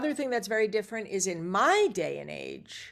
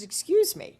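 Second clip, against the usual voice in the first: indignant excuse me; 12 semitones higher, 4 dB louder than usual. Retold or imitated. Angela stops her at trailer.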